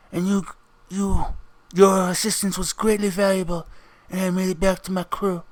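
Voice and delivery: quiet, formal crime boss addressing his follower; the Passion. Acted. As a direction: speak softly and deliberately, like a revered boss. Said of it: "So, the Passion looks at you, Richard, and goes".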